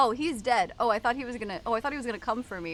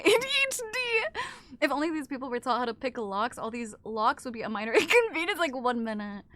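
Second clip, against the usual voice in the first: ordinary talk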